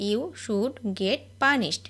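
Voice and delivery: very slow, word by word; each word drawn out for learners to copy; slow dictation. Shown as a demonstration. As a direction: spell it out slowly for the class. Repeated slowly for learners.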